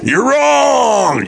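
teasing shout of you're wrong; mock yell. Real, not acted. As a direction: shout it back in fun. Playful argument, yelling back.

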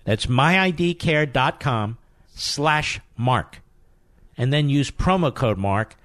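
slow, each part of the address set apart; slow, careful repeat. Shown as a demonstration. Host repeating the sponsor address for listeners. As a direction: spell it out again slowly. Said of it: "let's do that again".

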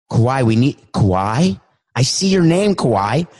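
usual speaking voice

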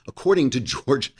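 ordinary talk

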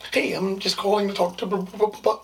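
high, loud phone-call chirp; Tiffany. Acted. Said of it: "You sound like Tiffany now".

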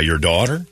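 DAUGHTER rising as a question; retold question, "daughter" going up. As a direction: lift the end of "daughter" into a question. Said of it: "kinda said it like a question".

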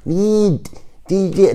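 نيد تيجي said high, tongue out; tongue out, mocking. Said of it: "ودا يطلع لسانه". (نطق نيد تيجي واللسان طالع)

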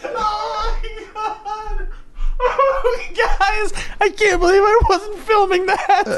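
high, breathless helpless laughter; laughing till you cry; laughing hard. Real, not acted. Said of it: "I am literally cry".

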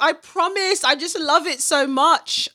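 pleading put-on British accent, pitched high. Caught doing the accent and promising no mockery, British accent. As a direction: apologise in an eager mock British accent. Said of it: "I will start doing a British accent".

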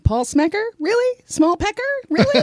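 squeaky high disbelief; can't believe what she's hearing; incredulous. Real, not acted. Host mocks the name, incredulous.

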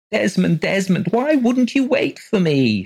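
high, loud pleading wail; plaintive call. A narrator's reading. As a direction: call out to him mournfully. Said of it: "she called plaintively".

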